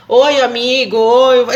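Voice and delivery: loud greeting, growing harsher; tone shifting. Retold or imitated. A man greeting his friend with a loud, changing tone. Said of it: "ele vai mudando".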